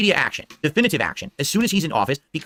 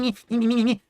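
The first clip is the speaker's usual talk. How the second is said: mocking whiny child, me me me; bratty kid whine; 7 semitones higher than usual. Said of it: "like little whiny kids".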